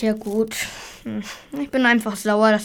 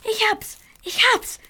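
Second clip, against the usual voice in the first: excited idea; 10 semitones higher, 4 dB louder than usual. Acted. Sudden idea for a tree.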